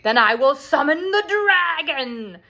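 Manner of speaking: the queen vows revenge in a rising fury; angry queen. Retold or imitated. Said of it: "And she says".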